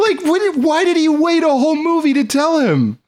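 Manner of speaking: squeaky high disbelieving whine; mock outraged high pitch; high incredulous voice. Mocking incredulous question.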